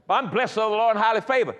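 loud, high mock-pious answer; religious stock reply. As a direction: imitate a churchgoer reciting a pat blessing. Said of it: "is that real or is that religious".

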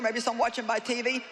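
ordinary talk

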